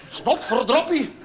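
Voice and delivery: slow, prissy little oath; prim wife's mild curse. Retold or imitated. Wife muttering a harmless oath at the motor, slow and prim. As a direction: say it like a woman who cannot swear. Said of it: "dat is voor haar wat".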